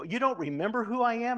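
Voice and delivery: high, loud feigned disbelief; mock surprise. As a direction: act amazed that he forgot you. Feigns surprise at not being recognised.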